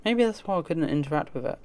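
usual speaking voice

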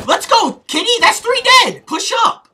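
high, loud triumphant yelling; excited shouting. Real, not acted. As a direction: cheer the team on at full volume. Coach celebrating a team kill.

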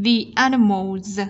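slow, THE said "thee" before "animals". Shown as a demonstration. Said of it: "đọc thành V".

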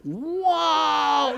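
long, high, hushed "wow"; captivated awe. Retold or imitated. Man watching the hawk fly at him, captivated awe. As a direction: marvel in open wonder. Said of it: "I'm captivated".

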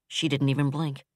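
ordinary delivery

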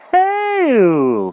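very high, loud, long greeting; overeager knight. A narrator's reading. King answers magician's greeting, overeager. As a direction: bellow it in a high hearty voice. King bellows his reply.

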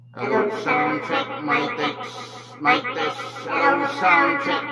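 very high, whispery processed voice; chipmunk-like electronic voice; high pitched voice changer. Shown as a demonstration. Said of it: "voice changer number two". (napakataas na boses mula sa voice changer)

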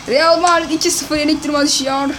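normal talking voice

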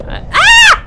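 sudden piercing, high scream; grito de susto. Real, not acted. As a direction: shriek in fright. Jump scare in horror game. (chillido fuerte de sobresalto)

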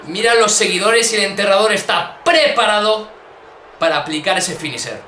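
ordinary talk, nothing marked

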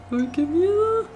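high frightened yelp; scared cry. Real, not acted. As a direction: squeal it in fright. Animatronic right at door.

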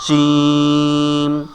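জিম from mid-tongue, vowel held long; correct jim pronunciation. Shown as a demonstration. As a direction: stretch জিম with a firm palatal j. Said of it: "ঠিক তার উপরে লাগাইতে হবে".

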